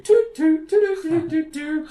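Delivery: very high, loud sung toots; recorder tooting imitation. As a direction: pipe out a little mock recorder tune. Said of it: "Toots playing the recorder".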